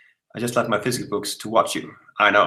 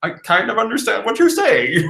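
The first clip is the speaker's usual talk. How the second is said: high-pitched quote; 11 semitones higher than usual. Retold or imitated. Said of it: "And they were like".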